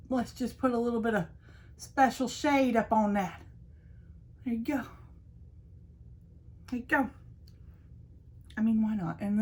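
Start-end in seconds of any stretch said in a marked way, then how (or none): 4.4-4.8 whispered. Real, not acted. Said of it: "whispered then repeated".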